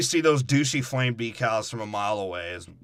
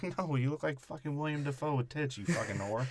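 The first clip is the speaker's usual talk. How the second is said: a snide, softer mock sneer; sneering insult; 10 dB quieter than usual. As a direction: murmur the insult with contempt. Acted insult, low and quiet.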